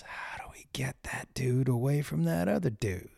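hushed scheming whisper; sneaky whisper. Retold or imitated. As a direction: whisper it like a coyote plotting. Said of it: "all they're thinking is".

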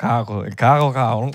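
CAJO with a throaty J for the rolled R; R boricua. Retold or imitated. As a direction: repeat CAJO as a Puerto Rican carro. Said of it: "los boricuas muchos no pronuncian la R". (cajo, erre boricua como jota)

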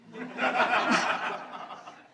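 unvoiced snorting laugh; laughing under the breath; breathy laugh. Real, not acted. Reader snickering at the story.

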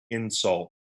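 wrong-syllable insult, in-SULT; Scott's misplaced stress. Retold or imitated. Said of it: "put all the emphasis on the wrong syllable".

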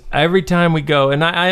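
usual speaking voice

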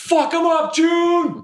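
high cheering shout. Acted. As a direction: shout encouragement from the crowd. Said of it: "he just shouts out".

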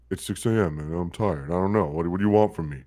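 low, groggy, mildly put-on tired guy. Kevin at six AM answering his confused coworkers, groggy and low. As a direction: lightly imitate a sleepy early-morning grump. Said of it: "Tired voice".

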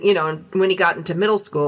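usual speaking voice

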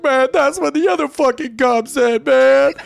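a hurt guy griping; whiny injured man. Retold or imitated.

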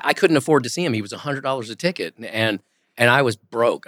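ordinary delivery

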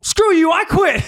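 high whiny mock rage; mocking Draymond. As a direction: mimic a grown man quitting in a huff. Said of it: "And he was like".

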